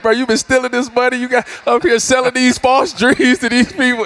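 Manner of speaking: high mocking taunt with laughs; teasing a rival coach from the sideline, laughing; taunting trash talk. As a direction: needle him like a rival. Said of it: "get to talking to him like".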